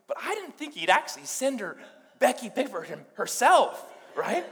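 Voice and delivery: high, incredulous build to a loud finish; imitated disbelief. Brother amazed God sent Becky, mock incredulous. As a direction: play comic astonishment, punching the final word.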